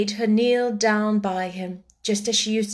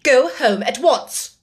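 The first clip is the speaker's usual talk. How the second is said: louder, slow, sharp order; indignant command; 4 semitones higher, 7 dB louder, slower than usual. Acted. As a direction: order the girl out with cold indignation. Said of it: "she commanded indignantly".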